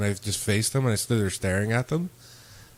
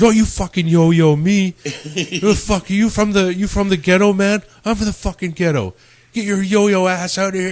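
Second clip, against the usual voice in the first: mock yelling; 9 semitones higher, 5 dB louder than usual. Bouncer exploding at a suburban kid who said yo, hammed-up yell. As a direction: explode at him, hammed-up fury. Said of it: "you start yelling at him".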